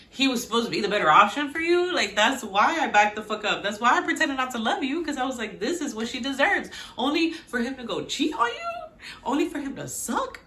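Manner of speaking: indignant rant. Acted. Host voices the spurned brother's complaint, indignant. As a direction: build an aggrieved case in his voice.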